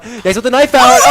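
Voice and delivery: high, shouting play call; esports caster. Real, not acted. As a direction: scream the play at full hype. Caster calling a clutch play.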